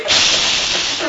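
imitated spray paint, long shushing hiss; spray-can hiss. Preacher pretends to spray yellow paint on the sign, spray-can hiss.

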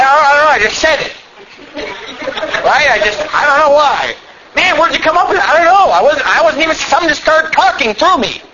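high, babbling mock bewilderment; acted-out flustered excuse. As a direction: mimic someone excitedly pleading they had no control. Acts out excuse and questioner.

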